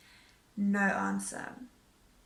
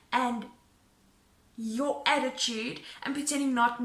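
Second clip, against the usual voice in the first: retold scream; 3 semitones higher, 8 dB louder than usual. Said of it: "I start screaming at this woman".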